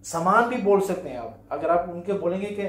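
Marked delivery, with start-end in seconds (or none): none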